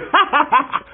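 high giggling laugh; mocking laugh. Boy laughing at the villagers, giggle. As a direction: chuckle at them.

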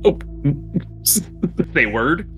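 usual speaking voice

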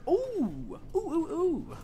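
high, eager oohs; excited oohs. Real, not acted. Streamer spotting the cavalry option, excited high oohs. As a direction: coo up high like you found a treat.

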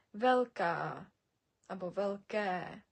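Велка and велке with the last vowel drawn out; long final vowel. Shown as a demonstration. Teacher showing learners, Велка and велке lengthened at the end. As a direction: stretch the endings, "vel-kaaa, vel-keee". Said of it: "Робимо наголос в кінці слова".

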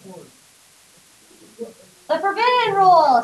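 slow, ominous "forbidden rule"; drawn-out spooky emphasis; dramatic slow. Acted.

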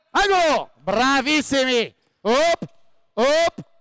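high loud calls during play; calling out. Real, not acted. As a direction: shout praise and cues. Coach calls the live drill. (richiami gridati in campo)

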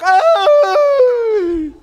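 put-on high whiny child's "oh"; granddaughter's fake cry; mock whimper. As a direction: whimper like a little girl. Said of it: "granddaughter's whimper".

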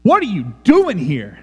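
acted alarm at an intruder; alarmed challenge. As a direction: challenge the unwanted visitor, hard on the first word. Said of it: "This is the inflection of this text".

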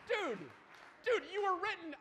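high-pitched, incredulous address. Acted. Speaker addressing an ancient man page, incredulous. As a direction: call out the old manual in disbelief.

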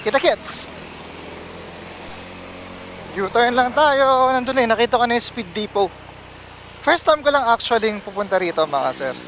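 ordinary talk

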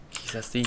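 kisashi said softly and clearly; Japanese reading. Shown as a demonstration. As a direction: model kisashi for students. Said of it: "Cách đọc của nó là kisashi".

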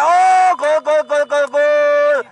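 excited goal shout; goal call. Real, not acted. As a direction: yell the goal out.

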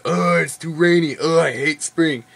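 mock whiny complaint about winter; mock complaining. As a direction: whine it like a grumpy Wisconsinite. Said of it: "And if it's spring".

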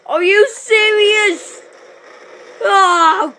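loud, high, drawn-out outburst; exasperated disbelief. Real, not acted. Gamer who just died, exasperated shouting.

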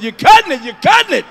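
high, loud cry of protest, acted out; pained mock yell. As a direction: yelp it as if it hurts too much to bear. Acted yelp of pain during sex.

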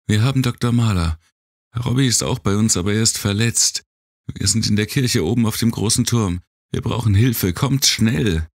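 fast, high urgent radio call; a girl begging for help over the radio; young girl voice. A narrator's reading.

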